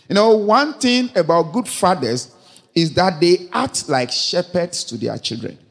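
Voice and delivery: ordinary talk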